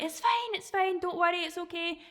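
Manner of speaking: very high, fussing "it's fine, it's fine"; frantic reassurance. Pretend. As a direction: overdo the soothing, panicky. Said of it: "so you're not going like".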